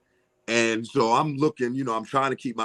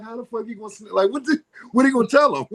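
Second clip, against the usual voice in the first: much higher incredulous rant; 12 semitones higher, 4 dB louder, faster than usual. Retold or imitated. He scoffs at Mike's snitch worry, high and incredulous. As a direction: disbelief rising to the top. Retold exasperated reply.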